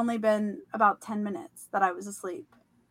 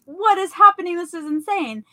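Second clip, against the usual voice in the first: high, louder incredulous outburst; mock-bewildered exclamation, pitched up; retold astonishment; 6 semitones higher, 6 dB louder than usual. Dreamer sees hundreds of men about to fight, astonished. Retold mass brawl breaking out.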